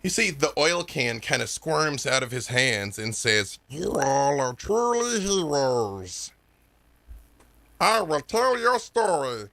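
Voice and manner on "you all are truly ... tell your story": slow, stretched-out creature voice; squirming oil can creature. Acted. Oil can praises the heroes, slow strange creature voice. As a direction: drag every word out in a strange put-on voice.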